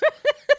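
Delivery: sudden high squealing laugh; high burst of laughter. Real, not acted. Laughing at turtle joke.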